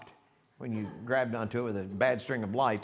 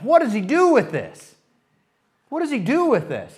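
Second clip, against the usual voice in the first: hammed-up bewilderment; 11 semitones higher, 13 dB louder than usual. Preacher dramatizing Charlie's dilemma.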